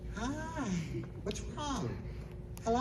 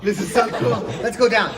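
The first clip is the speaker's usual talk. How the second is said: high, loud, laughing excitement; thrilled shout; 12 semitones higher, 14 dB louder than usual. Acted. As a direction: echo the thrill at full volume. Echoing subject's thrill at full pitch.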